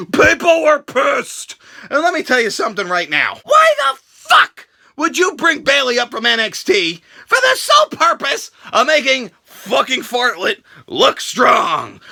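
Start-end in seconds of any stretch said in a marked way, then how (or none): none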